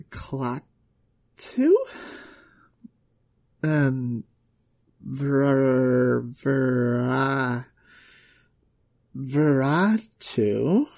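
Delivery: slow, low, drawn-out syllables; hesitant sounding-out. Acted. Girl reading unfamiliar spell from a book, hesitant. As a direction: sound out strange words uncertainly. Sounding out unknown magic words.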